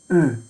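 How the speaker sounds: او said as German Ö; او, one thick umlaut Ö; thickened Ö sound. Shown as a demonstration. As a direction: say او as a slightly thick, rounded "öh".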